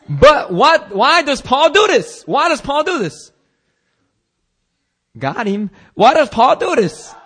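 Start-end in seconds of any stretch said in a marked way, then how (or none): 0.1-3.3 high, loud preacher's cry; pitched-up, emphatic rhetorical demand; impassioned questioning. Real, not acted. 5.9-7.1 high, loud preacher's cry; impassioned questioning. Real, not acted. Preacher repeats rhetorical question.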